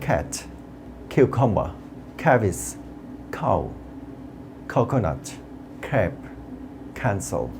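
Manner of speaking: C said as a puffed K, from "cat" to "cancel"; word-list drill, breathy K sound in "cow"; aspirated hard C. Shown as a demonstration. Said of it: "সেগুলো আমরা শুনতে পারি". ("cat", "cow"-এর ক খ-এর মতো)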